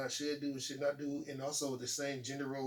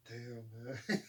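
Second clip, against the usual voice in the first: laughing damn man; 4 semitones lower, 5 dB quieter than usual. Retold or imitated. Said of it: "I was like".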